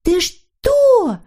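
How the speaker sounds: put-on very high indignant girlish cry. A narrator's reading. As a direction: protest in a high little voice. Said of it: "возмутилась Норочка". (возмущённо, высоким голоском)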